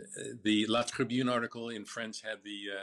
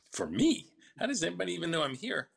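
higher-pitched, startled questioning; incredulous, raised-pitch retold reply; retold surprise; 7 semitones higher, 5 dB louder than usual. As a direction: react as if the call can't possibly be yours. Retold startled reply to unexpected call.

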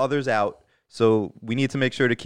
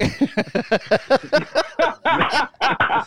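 high, long real belly laugh; high cackling laughter, genuine; 12 semitones higher than usual. Real, not acted. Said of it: "That is actually his real laugh".

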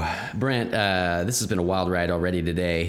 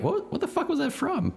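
mock confusion. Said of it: "we were both like".

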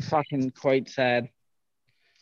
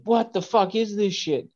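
higher, outraged brother voice; disgusted disbelief; 7 semitones higher than usual. Retold or imitated. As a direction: recoil at the bad weed. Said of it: "He's like".

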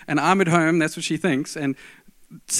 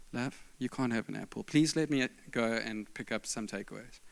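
soft pleading; 5 semitones lower, 11 dB quieter than usual. Retold or imitated. Pleading with wife on bed.

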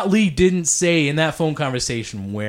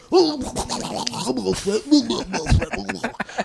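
a long laugh, a bit higher; real laugh; 6 semitones higher than usual. Real, not acted. Mocking his own phone pitch to the booker, long laugh.

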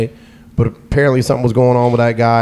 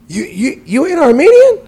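mock shock shooting much higher; shocked question; 4 dB louder than usual. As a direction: squeak it out in disbelief. Stranger shocked he's not Armenian.

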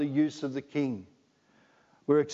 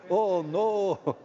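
high, lightly put-on Scottish oh no; mock Scot; 6 semitones higher than usual. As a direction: do a quick Scottish aside. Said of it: "Trust a Scot to say that, eh?".

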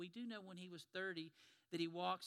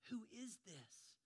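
slow, softer, stunned question; awed hush; 3 semitones higher, 8 dB quieter, slower than usual. Acted. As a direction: breathe it out in awe. Disciples awed by Jesus' power.

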